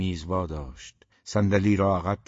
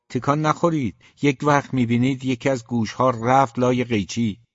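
higher, louder cautioning order; sharp warning; 6 semitones higher, 7 dB louder than usual. A narrator's reading. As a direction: warn them firmly to hold still. Warns him while cutting his hair. (هشدار با صدای بلندتر)

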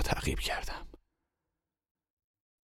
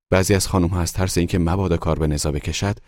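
plain reading voice

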